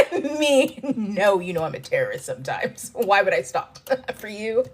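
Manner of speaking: put-on a laughing, terrible British accent; hammed-up British accent teasing British friends; mock British accent. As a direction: tease in a bad British accent. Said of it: "I have a terrible British accent".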